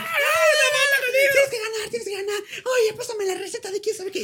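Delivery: very high shrieking fan ladies; hammed-up piercing high squeal; imitated mob of señoras. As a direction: shriek at him like an excited señora. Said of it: "me abordan como cincuenta señoras".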